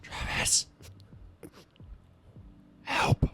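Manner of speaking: retold plea for help. Mom calling for help on phone.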